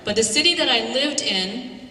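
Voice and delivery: ordinary delivery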